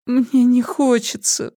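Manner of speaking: high, slow whimper. A narrator's reading. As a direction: whine it out pitifully. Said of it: "проскулила она".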